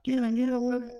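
high excited yeah yeah; a huddle of hyped players, squeaky high; mock hype.

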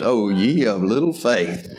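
low, wry teasing delivery; smart aleck. Retold or imitated. Preacher voicing Jesus teasing his disciples, smart aleck. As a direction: tease them like a knowing smart aleck. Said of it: "in one of those smart aleck ways".

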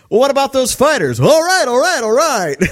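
high, loud hammed-up alarm; comic panicked squawking; mock panic. Acts out panicked cockpit exchange.

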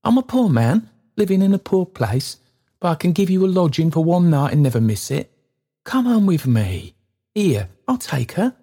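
put-on kindly old man pressing his offer. Trotty offering the stranger lodging, eagerly.

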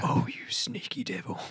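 sly stage whisper. Pretend. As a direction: breathe it out conspiratorially. Mock whispered aside.

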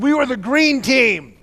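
slow, high indignant shout; acted furious kid bellow; snapping in anger. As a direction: yell it at dad, drawing out the words. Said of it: "I snapped".

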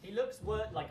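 ordinary delivery

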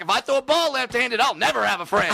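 high, shouted mock parents. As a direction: squeal it out mockingly.

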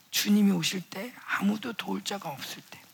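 unvoiced, intimate hush; hushed whisper. Real, not acted. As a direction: drop to a whisper for the promise. Preacher drops to a hush.

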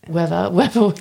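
playful put-on "weather", breaking into a laugh; jokey mock pronunciation of "weather"; Pinkley Binders impression. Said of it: "Your best Pinkley Binders, uh, impression".

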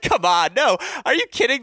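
gushing at the coach in delight; overjoyed protest. Retold or imitated. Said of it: "I was like".

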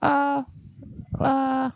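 puppet voice. Pretend.

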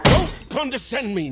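high, loud indignant outburst; patient furious at being talked down to; angry snap. Acted. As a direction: bite back at the nurse. Patient snaps at nurse.

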